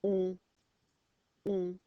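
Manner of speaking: "On" pushed through the nose, loud; nasal French on. Shown as a demonstration. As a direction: say "On, on" with a strong nasal French vowel. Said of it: "You push your nose up and you go".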